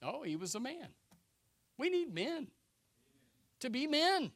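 usual speaking voice

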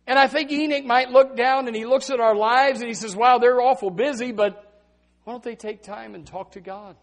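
loud, high preaching build; full-throated sermon climb; preaching at full force. Acted. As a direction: drive it home like a revival preacher.

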